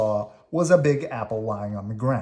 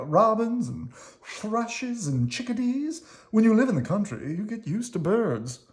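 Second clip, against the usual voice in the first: high worm voice, matter-of-fact; Squirmy the country worm; 9 semitones higher than usual. Acted. Squirmy explains country life to Slimy, in a high breezy voice. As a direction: list the birds breezily.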